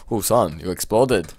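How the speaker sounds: ordinary talk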